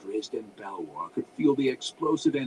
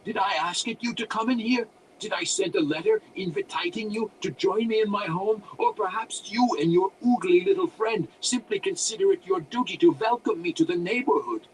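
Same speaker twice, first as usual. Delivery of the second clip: high, loud cranky wizard voice; indignant, accented crazy-hermit tirade; rambling rant; 10 semitones higher, 8 dB louder than usual. A narrator's reading. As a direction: rant sarcastically at unwanted guests. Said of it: "as the human rambled on".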